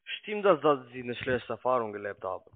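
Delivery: ordinary talk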